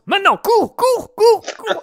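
high excited shout to run. Pretend. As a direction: yell it urgently. Host yells at player to run.

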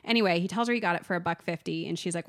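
normal talking voice

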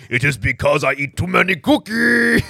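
hint of a munching monster; Cookie Monster. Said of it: "Spooky Johnny Knobs".

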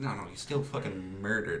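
ordinary talk

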